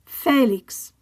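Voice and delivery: Félix with FÉ stressed; stress on accented syllable. Shown as a demonstration. Teacher modelling written accents, Félix stressed on first syllable. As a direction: say Félix in Spanish, FÉ-lix. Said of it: "stress the syllable that the accent is on".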